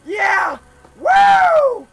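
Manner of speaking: loud, high victory whoops; excited cheering. Real, not acted. Gamer to friends after scoring, loud cheering. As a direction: shout it out like you just scored.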